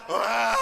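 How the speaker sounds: long mock sob, "waah"; hammed-up crying noise; imitated wailing. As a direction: bawl in exaggerated bursts like children. Children crying when reminded of mother.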